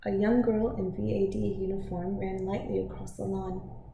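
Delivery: ordinary delivery, nothing marked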